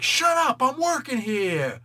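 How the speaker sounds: high, loud hollered retort; irritated shout. Retold or imitated. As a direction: yell at a heckler, drawing out the end. Ghost Rider snaps at onlooker.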